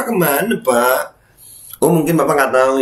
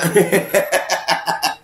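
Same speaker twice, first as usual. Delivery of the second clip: short laugh; 6 semitones higher than usual. Real, not acted. Laughing after "Luhut menang.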